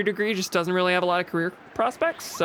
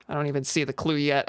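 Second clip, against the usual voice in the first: usual speaking voice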